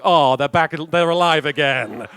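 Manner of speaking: hammed-up joyful exclamation; mock excitement. Voices everyone cheering the revived puppies.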